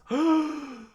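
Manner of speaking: long, loud intake of breath; big theatrical gasp; crowd's shocked gasp. Acted. Crowd seeing their king struck, loud shocked gasp. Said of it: "the crowd gasped".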